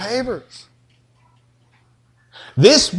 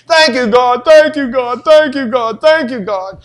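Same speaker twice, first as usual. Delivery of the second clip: higher, louder droning thank-you prayer; mock repetitive thanks; 5 semitones higher, 5 dB louder than usual. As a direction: rattle off thanks like a stuck record. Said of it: "just by saying".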